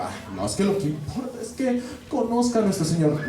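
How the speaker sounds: high-pitched imitated protest; mock pious catechist. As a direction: parody a catechist insisting piously. Voices the pious catechist's excuse.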